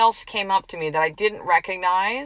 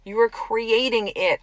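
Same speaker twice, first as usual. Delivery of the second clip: ordinary talk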